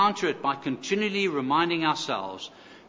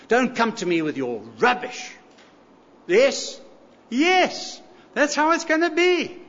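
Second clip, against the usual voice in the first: stern rebuke; 6 semitones higher, 4 dB louder than usual. Acted. God voiced rebuking the world.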